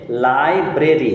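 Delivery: Library stretched, first syllable held long; broken into syllables. Shown as a demonstration. Teacher returns to the word for the child, syllable by syllable. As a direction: split library into slow beats. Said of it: "তিনবারে ভেঙে উচ্চারণ করা যায়".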